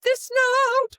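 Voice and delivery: loud high held note; top of a limited range. Shown as a demonstration. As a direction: sing out the note well above speaking pitch. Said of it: "could not go above".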